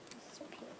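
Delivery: whispered wonder; a soft awestruck whisper; awed whisper. Real, not acted. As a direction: breathe it out in a hushed whisper. Same words whispered in awe.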